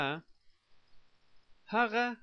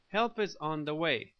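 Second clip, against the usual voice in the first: usual speaking voice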